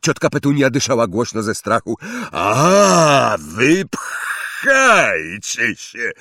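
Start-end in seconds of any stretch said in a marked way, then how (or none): 2.3-6.1 loud, slow scornful blast; scornful giant. Acted. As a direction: dismiss them with contempt. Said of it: "powiedział Hagrid".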